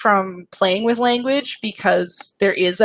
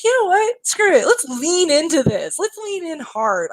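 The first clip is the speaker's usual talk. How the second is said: much higher, rallying retelling; retold defiant resolve; 9 semitones higher than usual. Game maker retelling her decision to lean in hard, high and defiant. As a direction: recount throwing caution aside, pitched way up.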